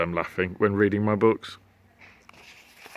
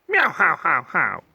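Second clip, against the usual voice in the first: meowing laugh; cat laughter; 5 semitones higher than usual. Acted. Cats laughing at Slime's growl. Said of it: "laughed at this pitiful display".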